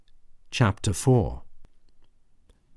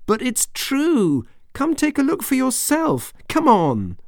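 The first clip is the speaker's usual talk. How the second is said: angry shout. Acted. Bella angry that her parents won't believe her, shouting. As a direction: insist hard, near tears. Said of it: "She kicked a chair and shouted".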